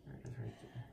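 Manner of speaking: hushed whisper. Real, not acted. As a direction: point it out in a whisper. Dad filming the iceberg.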